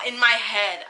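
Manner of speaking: usual speaking voice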